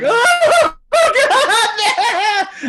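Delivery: very high, loud laughing shout; high yelled joy breaking into laughter; crowd's joyous shout. Retold or imitated. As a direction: cheer it like a stunned fan. Crowd erupting at Han line.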